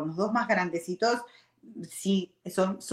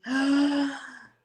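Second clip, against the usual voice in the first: startled gasp; 6 semitones higher than usual. Retold or imitated. Sudden fright recalled.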